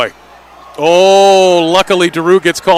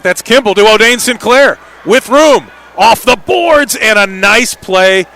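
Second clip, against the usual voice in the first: loud, high shout, slowing at the end; excited play-by-play rising to a shout; excited call; 5 semitones higher, 5 dB louder than usual. Real, not acted. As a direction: shout the chance, then savour it. Attacking play off the boards.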